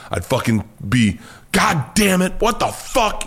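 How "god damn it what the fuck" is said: high-pitched exasperated outburst, acted out; raised-voice anger. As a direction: re-enact blowing up, pitch jumping high without much added volume. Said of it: "I'd raise my voice".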